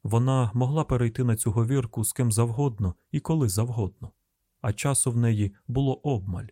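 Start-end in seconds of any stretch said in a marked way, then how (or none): none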